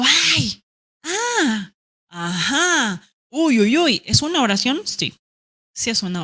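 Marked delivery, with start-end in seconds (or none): 0.0-4.0 interjections. Shown as a demonstration. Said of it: "eso que digo".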